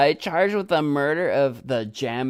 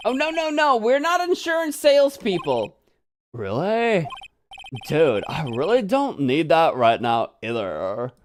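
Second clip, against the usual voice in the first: beach bum voice. Acted. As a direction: play a carefree surfer, pitched up. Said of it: "This is my beach bum voice".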